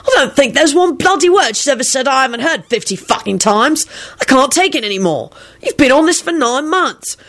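high-pitched exasperated rant; rude snap. A narrator's reading. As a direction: snap rudely at a tiresome friend. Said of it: "he snapped rudely".

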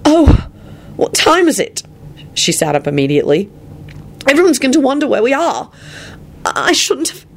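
high, alarmed outburst; panicked. A narrator's reading. Woman seeing morning sunlight through windows, panicked. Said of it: "with a panicked expression".

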